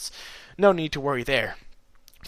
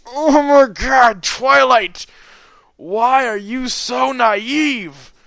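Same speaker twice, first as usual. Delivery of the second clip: loud, high, drawn-out wail of exasperation; slow, pleading over-the-top yell; exasperated outburst; 10 semitones higher, 9 dB louder, much slower than usual. Acted. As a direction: stretch every word in hammed-up despair. Reader's exasperated outburst at the character.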